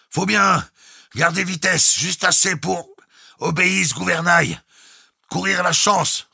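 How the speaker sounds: high, loud, word-by-word shout; every word detached. A narrator's reading. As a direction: call out each phrase separately and distinctly. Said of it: "détachant distinctement tous les mots". (crié en détachant chaque mot)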